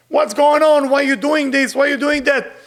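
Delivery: loud, pressing reporter questions; reporters asking. Retold or imitated. Cameramen at the riot shouting questions at looters, loud. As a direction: demand answers like a pushy reporter. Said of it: "and they asking".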